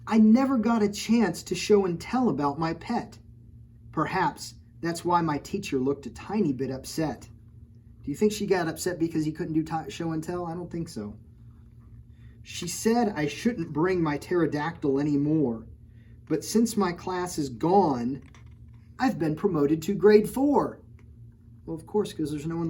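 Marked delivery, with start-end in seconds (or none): 0.0-7.3 rhyming picture-book read-aloud; story narration. A narrator's reading. Reading a rhyming storybook to children, lilting. Reads next page. 12.4-20.8 rhyming picture-book read-aloud; story narration. A narrator's reading. Reading a rhyming storybook to children, lilting. Reads next page.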